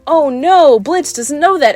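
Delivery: mock dismay. Viewers learning what Blitz doesn't know, mock dismay. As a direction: imitate an audience gasping along in worry. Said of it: "we're supposed to be like".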